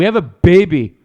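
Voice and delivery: mock outraged wife; Conor's wife. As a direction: protest as a wronged wife, put on. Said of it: "you Connor's wife".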